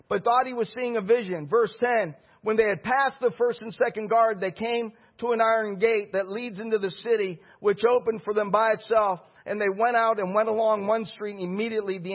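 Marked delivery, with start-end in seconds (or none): none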